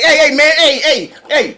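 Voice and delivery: high, loud, laughing hey; laughing protest. Acted. Caught by Thanos in the theater, laughing protest.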